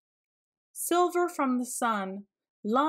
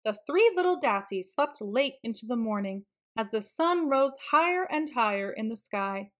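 plain reading voice

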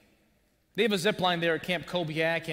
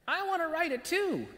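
put-on much higher little-kid voice; high piping toddler voice; toddler's voice; 11 semitones higher than usual. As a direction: pipe up like a two-year-old. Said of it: "two-year-old, pipes up".

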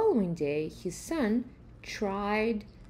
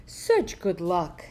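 slow, louder, delighted neighbor voice; crying out; 2 semitones lower, 5 dB louder, slower than usual. Acted. Neighbors rejoice at the farmer's luck, slow and louder. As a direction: cheer at his fortune. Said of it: "cried the neighbors".

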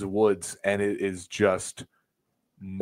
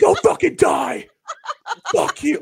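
imitated high, loud heckle; mad fan yelling; 8 dB louder than usual. Rival fans at celebrating fans, mad imitated shout. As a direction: hurl abuse like an irate crowd. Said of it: "they're so mad".

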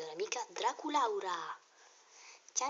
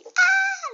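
excited shriek; 19 dB louder than usual. Acted. Doll excited she knew question.

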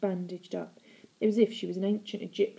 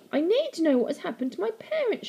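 put-on high, urgent girl's outburst; impassioned high-pitched demand; shouting; 10 semitones higher than usual. A narrator's reading. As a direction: cry it out in a high, desperate voice. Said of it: "shouted the girl".

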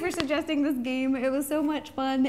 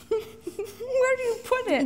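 high, laughing through a full mouth; 8 semitones higher, slower than usual. Real, not acted. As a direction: giggle out the words, cheeks full.

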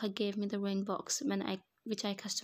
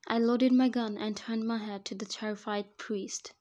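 plain reading voice

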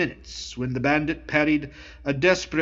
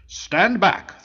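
higher, louder, barked order; thundering command; 7 semitones higher, 4 dB louder than usual. A narrator's reading. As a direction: thunder it at him. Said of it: "thundered the bandit chief".

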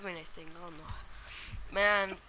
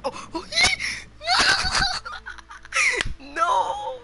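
raging gamer; 14 semitones higher, 5 dB louder, much slower than usual. Real, not acted. Losing the race at the last second.

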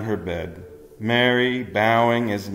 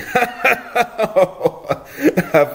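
a high rumbling angel chuckle; amused chuckle in the bedroom; chuckling; 12 semitones higher than usual. A narrator's reading. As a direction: laugh warmly at the joke. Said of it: "It was the angel chuckling".

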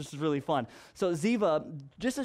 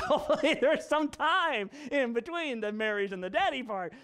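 laughing aside; 6 semitones higher than usual. Retold or imitated. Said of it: "And I'm like".

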